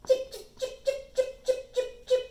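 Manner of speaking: short, sharp high chips; high, loud staccato bird chips; sparrow chirp. Shown as a demonstration. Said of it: "see if I can make a sparrow sound".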